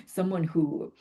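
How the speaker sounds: ordinary talk, nothing marked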